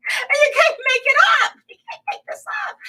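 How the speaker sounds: high, fast, laughing disbelief; incredulous laughing. Real, not acted. Host marvelling at the scandal to viewers, laughing high. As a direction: squeak it out through laughter.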